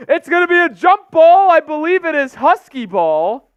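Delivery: loud high commentator shout, gradually coming down; going nuclear, easing. Real, not acted. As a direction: keep yelling the call, then start to settle. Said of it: "I go nuclear when we get a wedgie".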